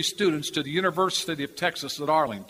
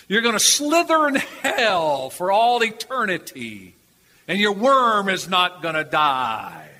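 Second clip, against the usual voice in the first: slow, raised hellfire preacher voice; hellfire preaching; 2 semitones higher than usual. Retold or imitated. Street preacher condemning students, slow and raised. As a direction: thunder it down slowly like a street preacher. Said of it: "he would start preaching".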